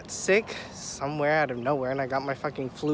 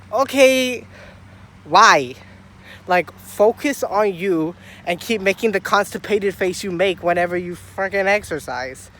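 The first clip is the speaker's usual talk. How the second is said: acted-out exasperated telling-off, pitched up; mock indignant, hit the first words loud; irritated; 3 semitones higher, 4 dB louder than usual. Telling off a staring man at the gym, irritated. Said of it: "get irritated when people fucking stare".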